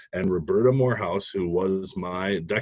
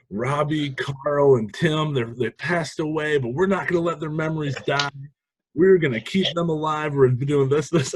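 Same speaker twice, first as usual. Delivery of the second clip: higher, impassioned competition speech, a bit hammed-up; rally the judges like it's a memorial; half-joking retelling of an over-the-top tearful pitch; mock dramatic pitch; 7 semitones higher than usual. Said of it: "I went full boat with it".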